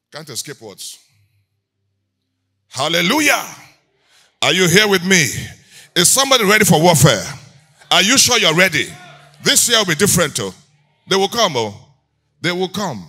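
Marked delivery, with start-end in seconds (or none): 2.7-13.0 loud rallying preacher's call; preaching at full force. Real, not acted. Preacher readies the congregation for warfare, loud rallying call. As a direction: fire up the congregation, loudly.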